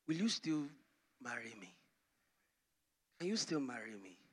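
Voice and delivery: pleading softly. Acted. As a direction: ask again quietly, swallowing your pride. Man pleading again after rejection.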